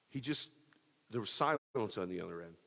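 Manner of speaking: ordinary talk, nothing marked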